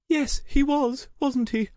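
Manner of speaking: plaintive, high-pitched fondness; tremulous smile. A narrator's reading. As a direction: agree wistfully through a tearful smile. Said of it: "gave her a tremulous smile".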